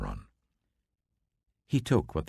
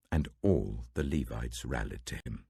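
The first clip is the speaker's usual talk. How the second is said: ordinary delivery, nothing marked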